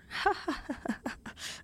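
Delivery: soft, breath-only chuckle; airy giggle that trails out; self-amused laugh. Real, not acted. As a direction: laugh quietly without voice at your own boast. Laughs at own boast.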